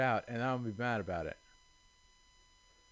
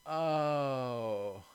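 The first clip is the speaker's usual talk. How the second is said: ordinary talk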